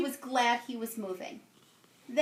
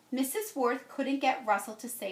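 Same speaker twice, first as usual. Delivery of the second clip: ordinary delivery, nothing marked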